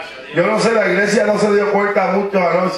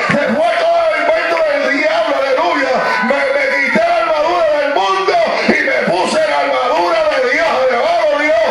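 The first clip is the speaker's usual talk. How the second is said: preaching at full force; 7 semitones higher than usual. Acted. Pastor exhorting the church, preaching at full force. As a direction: preach it hard to the congregation.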